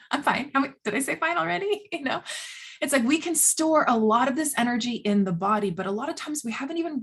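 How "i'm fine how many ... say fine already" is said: mock flustered denial. Mocking repeated "I'm fine.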